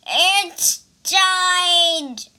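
a loud, high panicked cry; cried out. Acted. Pig cries out as the vest tightens. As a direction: yell it out in alarm. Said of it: "cried Bajie".

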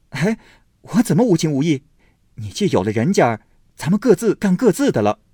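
put-on loud, fast indignant young man; loud retort. A narrator's reading. Baoyu snaps back at Daiyu, loud and fast. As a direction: throw the words back at her.

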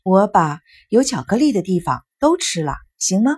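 ordinary delivery

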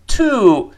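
"To" with a long, high, loud oo; full form. Shown as a demonstration. Teacher shows unreduced "To", long full oo vowel. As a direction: stretch "To" into a full "too". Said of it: "那个里面的".

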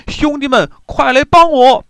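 high, loud yell for help while fleeing; panicked shout for help. A narrator's reading. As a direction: scream to your men to save you. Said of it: "一边跑一边大喊".

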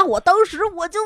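ordinary talk, nothing marked

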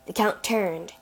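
ordinary delivery

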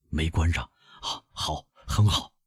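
murmured under the breath, soft and low; hushed, breathy undertone. A narrator's reading. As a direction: say it quietly to himself. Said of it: "他低声地说".

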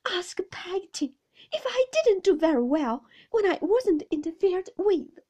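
high, loud, upset appeal; tearful protest. A narrator's reading. Mother appealing to her husband about interference, loud and tearful.

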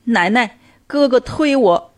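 put-on high, slow, whimpering child's plea; tearful little kid telling on her brother; pitiful small-child whine; pitiful little girl. A narrator's reading. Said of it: "可怜巴巴地说".

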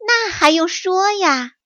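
high, knowing fox voice; crafty fox, sure of itself; sly fox. Acted. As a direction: fox answering as if it's obvious. Fox voice in story.

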